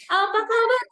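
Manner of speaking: high, loud stage greeting; energetic MC greeting. Shown as a demonstration. MC greeting hundreds of guests, loud and high. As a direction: hype up a huge crowd. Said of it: "Nggak bisa kita jawab".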